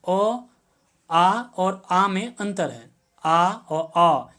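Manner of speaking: ऑ said very slowly, lips rounded, against अ; borrowed vowel demo. Shown as a demonstration. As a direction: stretch out the rounded ऑ, then contrast it with अ. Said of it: "आ का जो उच्चारण हम करते हैं". (आगत स्वर ऑ का धीमा उच्चारण)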